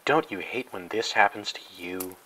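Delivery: ordinary talk, nothing marked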